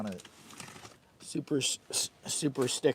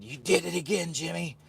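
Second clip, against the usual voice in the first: put-on higher, louder scolding old man; imitating Pops scolding; 6 semitones higher, 5 dB louder than usual. Pops scolding Jimmy for acting up, mock scold. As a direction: scold like an exasperated dad. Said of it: "Pops said".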